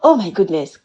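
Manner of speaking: normal talking voice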